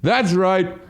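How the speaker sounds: imitating a drunk comic rallying boos; wasted comic. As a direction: egg the crowd on, mock triumphant. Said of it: "he must have been wasted".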